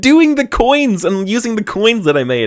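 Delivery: high, loud flustered protest; flustered excuse. Real, not acted. Game master excusing a slip to players, high and flustered.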